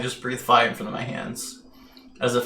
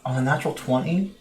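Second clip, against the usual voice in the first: normal talking voice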